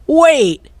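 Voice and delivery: high desperate call; cried out. A narrator's reading. Pirate captain cries out to his fleeing crew. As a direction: shout after the boat. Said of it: "cried Cap'n Bones".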